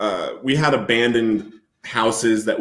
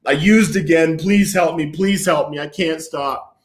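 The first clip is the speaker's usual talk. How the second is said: higher-pitched, repeated begging; retold crying plea; 5 semitones higher than usual. Man confessing relapse to Bible study friends, retold tearful plea. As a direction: beg for help, fading on the last line. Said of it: "I'm crying and I'm like".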